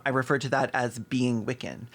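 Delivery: normal talking voice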